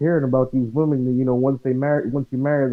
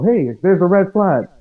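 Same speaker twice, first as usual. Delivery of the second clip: raised, high-pitched call-out; demonstrated yell; 7 semitones higher, 6 dB louder than usual. Retold or imitated. Man showing how he points out red flags, yelling. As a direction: yell it out like a warning. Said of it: "I yell".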